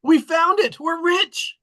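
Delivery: high, loud cry of joy; gold fever bursting out; yelling in triumph at the treasure; thrilled shout. Acted. Mikey finds treasure.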